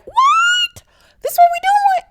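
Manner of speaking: shrieked very high disbelief; shocked. Real, not acted. Viewer reacting to the hit, shrill shocked disbelief. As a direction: squeal it in shock. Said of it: "I'm like".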